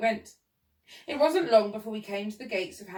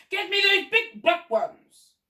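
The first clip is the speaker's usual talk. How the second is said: slow, high, loud demand; shouting; 11 semitones higher, 8 dB louder, slower than usual. A narrator's reading. Old man orders gardener up the tree, shouting. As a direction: bellow each word as an order. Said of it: "the man was shouting".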